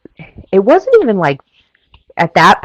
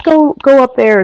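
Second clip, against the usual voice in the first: high, louder urging, retold; imitated yelling; 9 semitones higher, 5 dB louder than usual. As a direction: imitate a volunteer urging someone along. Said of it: "like, yelling at me".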